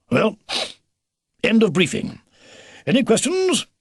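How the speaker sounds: brisk officer's sign-off, rising high; old beaver commander. Acted. Old beaver closing the briefing, brisk and military. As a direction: wrap up and lift the question high.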